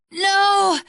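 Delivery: loud, high anguished shout; dying cry. Acted. Dragon struck down, shouting in anguish. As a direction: cry out as you fall.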